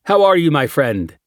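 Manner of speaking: loud, high friendly greeting; hearty Spanish-accented welcome. A narrator's reading. Lawyer greets warmly.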